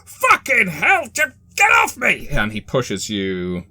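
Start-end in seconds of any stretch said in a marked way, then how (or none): 0.1-2.3 high, loud, tearful yelling to get off; panicked crying scream. Acted. Fake Jesus shoving off his attacker, crying out in panic. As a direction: shriek in pain and panic, pushing her away. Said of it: "his accent abruptly dropping".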